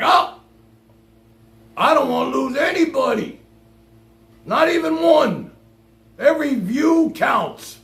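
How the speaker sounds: high, loud mocking whine, slowing at the end. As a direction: mimic him begging to keep every viewer. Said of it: "and you're like".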